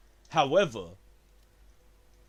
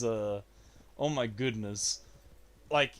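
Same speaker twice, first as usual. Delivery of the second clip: usual speaking voice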